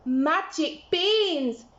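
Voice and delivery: high, loud, slow delight; boy delighted with magic beans; thrilled Jack. Acted. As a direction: savour each word with joy.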